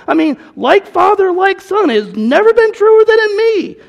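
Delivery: loud, high, exasperated rebuke; frustrated Jesus. Retold or imitated. Jesus scolding Philip for not knowing him, loud and exasperated. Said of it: "Jesus getting a little frustrated, and saying".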